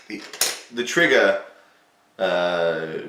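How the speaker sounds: ordinary delivery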